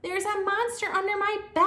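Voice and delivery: high, loud scared yell; little girl yelling about the monster; yelling child. Acted. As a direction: yell the warning at grandma. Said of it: "I yelled".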